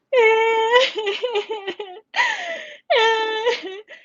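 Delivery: high wailing laugh-cry; imitated wailing cry. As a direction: let out a strange high sob-laugh. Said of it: "this cry that I've never heard before".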